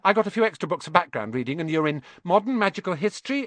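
put-on high, fast, know-it-all girl; very fast, bossy. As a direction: rattle it off without pausing for breath. Hermione voice continues.

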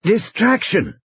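high, strained wheeze; soft wheeze. A narrator's reading. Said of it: "His voice was soft, almost a wheeze".

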